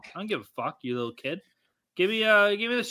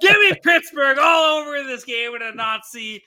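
hyped shout; 7 semitones higher, 8 dB louder than usual. Pretend. Hyped pick announcement.

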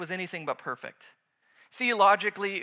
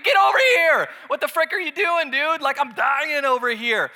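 much higher, louder, frantic yelling; mock furious shout; 7 semitones higher, 8 dB louder than usual. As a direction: yell at him in hammed-up outrage. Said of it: "You know, like, I mean".

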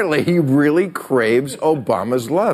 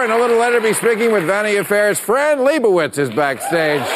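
host announcement; 7 semitones higher than usual. Acted. Host announcing tonight's guests to the audience, raised voice. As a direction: announce the guests to the studio crowd.

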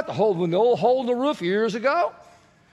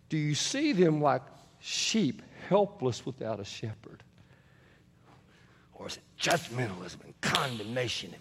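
hushed earnest preaching; 6 semitones lower, 5 dB quieter than usual. Real, not acted. Intimate probing close of the point.

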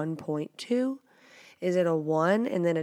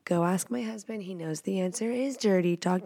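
ordinary talk, nothing marked

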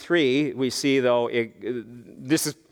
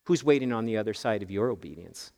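quiet repeat; 7 semitones lower, 6 dB quieter than usual. Shown as a demonstration. Preacher repeating the question to listeners, lower and softer. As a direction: lower and much softer, asking it again. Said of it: "repeated question".

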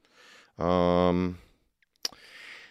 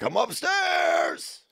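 yelling across the room; 10 semitones higher, much slower than usual. Pretend. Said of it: "And you're like".

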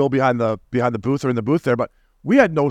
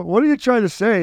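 higher-pitched mock challenge; retold yelling; 8 semitones higher than usual. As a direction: imitate an irritated comeback from a phone row. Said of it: "I'm yelling at him".

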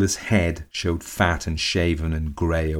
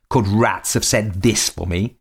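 louder, higher angry outburst; shout in fury; 4 semitones higher, 4 dB louder than usual. A narrator's reading. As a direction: lurch at her with the words. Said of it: "he shouted".

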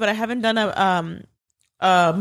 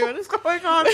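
high laughing question; 8 semitones higher than usual. Retold or imitated. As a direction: ask it while laughing. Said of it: "I was like".

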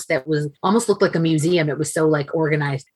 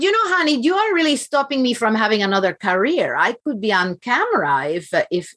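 put-on high, lilting Dominican-accented mom voice; mock-indignant mother with a Dominican accent; imitating Dominican mother; 6 semitones higher than usual. Mother teasing her daughter about a stolen career, playful Dominican accent. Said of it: "it's me imitating my own mother".

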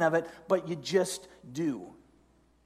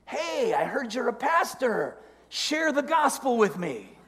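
higher, lightly voiced hopeful asker; imagined eager neighbor; 6 semitones higher than usual. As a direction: pipe up as if asking the pastor a favor. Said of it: "Maybe he'll come to you and say".

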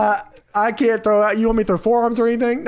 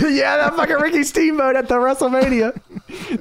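ordinary delivery

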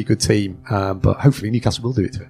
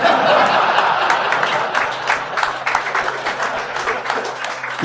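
breathless, airy real laughter. Real, not acted. Laughing at his own joke.

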